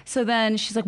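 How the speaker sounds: ordinary delivery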